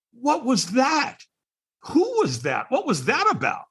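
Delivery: high, drawn-out bewildered questions; baffled disbelief. Retold or imitated. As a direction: replay your total confusion. Retold bewilderment after stranger vanishes.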